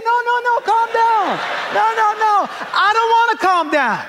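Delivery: high, loud comic tantrum; disproportionate anger. Acted. Husband refusing his wife's calming, mock-hysterical shouting. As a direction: refuse in a hammed-up screaming fit. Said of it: "in a disproportionate kind of a way".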